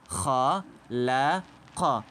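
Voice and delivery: Halaka stretched out very slowly, each syllable held; slow Qur'an-style model reading of Halaka; slow drawn-out reading. Shown as a demonstration. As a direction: draw out every sound of Halaka like a reciting teacher. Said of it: "Nasıl okuyacağız?".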